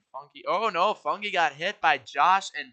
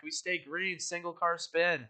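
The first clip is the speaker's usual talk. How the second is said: ordinary talk, nothing marked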